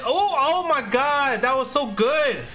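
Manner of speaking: high, drawn-out amazed cheer; thrilled. Real, not acted. Gamer delighted by his own good play, thrilled. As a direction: stretch the words out in high-pitched delight.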